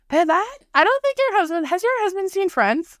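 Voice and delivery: high half-shouted call across the room; retold yell. To her husband while moving furniture, a mock yell. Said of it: "I have to yell".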